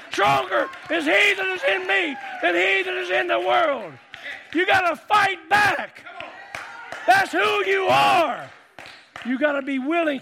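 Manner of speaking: loud, high-pitched revival preaching; fiery pulpit cry, stretching the big declarations; full-force preaching. Real, not acted. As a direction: preach it at a shout, driving every phrase home.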